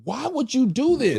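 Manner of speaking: high, stunned disbelief; high incredulous wife. Retold or imitated. Melissa silently glaring at the fan, a high incredulous cry.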